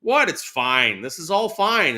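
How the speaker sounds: hammed-up nothing-to-see-here; mock breezy denial. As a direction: play the cheerful denier over a disaster. Brushing off friend's worry.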